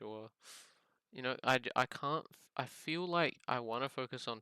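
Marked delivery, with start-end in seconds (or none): none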